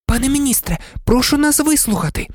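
put-on high, earnest girl's voice; pleading girl. A narrator's reading. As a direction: beg politely to be heard. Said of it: "благально зазирнула".

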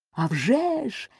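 high, sharp angry retort; furious shout. Acted. Queen yells furiously before throwing the inkpot. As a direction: snap back in a fury. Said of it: "люто крикнула королева".

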